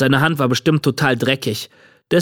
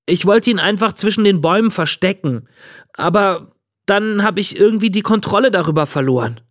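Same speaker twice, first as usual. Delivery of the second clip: higher-pitched, earnest excuse; defensive boy; 7 semitones higher than usual. A narrator's reading. Boy to the old man about the runaway trolley, defensive. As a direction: explain yourself in an anxious rush of justification.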